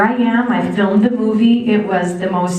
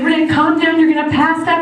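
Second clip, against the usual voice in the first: high-pitched, worked-up pleading; lightly weepy, urgent warning; mock tearful father; 8 semitones higher than usual. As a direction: imitate an emotional dad urging calm. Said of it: "My father's crying".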